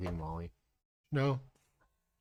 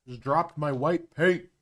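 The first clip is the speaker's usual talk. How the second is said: high, loud dismay; real startled outburst; 6 semitones higher, 6 dB louder than usual. Real, not acted. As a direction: exclaim over a sudden mishap. Has just dropped the paint.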